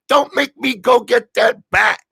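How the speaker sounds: high, loud imitation of a scolding; mock threat. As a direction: threaten him in comic outrage. Voicing her threat to James.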